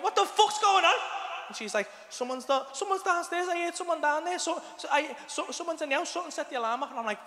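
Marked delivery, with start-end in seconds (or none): 0.0-0.9 high, loud panicked yell; urgent bellow to someone on another floor; shouting upstairs. Retold or imitated. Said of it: "I'm shouting up to him". 2.1-6.9 high, stammering, hushed alarm; mock panicky report from upstairs; imitating frightened partner.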